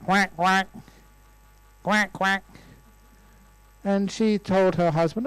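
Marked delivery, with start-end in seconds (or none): 0.0-2.4 short, higher-pitched duck quacks. Retold or imitated. Storyteller imitating the sound a couple heard, duck quacks. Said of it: "they heard the sound".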